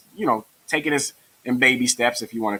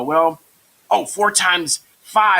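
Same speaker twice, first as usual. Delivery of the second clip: high, loud, slow pupil imitation; raised voice of a student realizing; 7 semitones higher, 5 dB louder, much slower than usual. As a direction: play a kid hitting on the answer. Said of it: "And they go".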